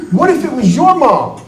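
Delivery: high, loud mock yell of a pleading family member. Family member yelling at nursing home staff, mock shout. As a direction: yell it like a frantic relative. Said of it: "They start yelling".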